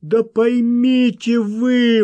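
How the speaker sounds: loud, high, drawn-out entreaty; imploring. A narrator's reading. Wild man begging the egg sellers, imploring loudly. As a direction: beg loudly, stretching the plea. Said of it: "взмолился бешеный".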